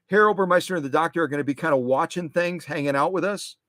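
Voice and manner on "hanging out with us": ordinary talk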